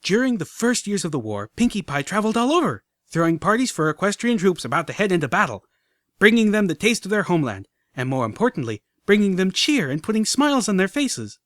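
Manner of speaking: high, loud rushing tour spiel; a filly racing through her speech; on a roll. Acted. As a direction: barrel on past questions. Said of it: "on a roll".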